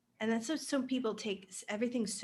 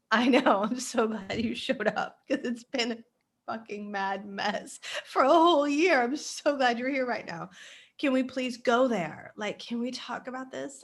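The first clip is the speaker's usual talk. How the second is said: excited; 3 semitones higher, 8 dB louder than usual. Pretend. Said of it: "And I'm gonna be like".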